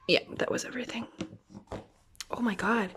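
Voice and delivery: quick breathless whisper; awed whisper. Real, not acted. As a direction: whisper it, overwhelmed. Whispers in awe.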